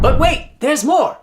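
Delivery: high, loud infomercial pitch; infomercial hype. Acted. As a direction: sell it like a TV pitchman. Infomercial catchphrase.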